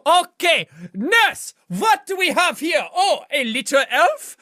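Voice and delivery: hammed-up German-accented quack doctor; thick mock-German doctor voice; mad German doctor. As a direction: play a theatrical German surgeon. Plays a German-accented doctor character.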